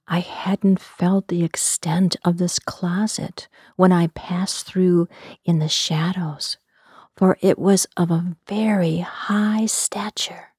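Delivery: ordinary delivery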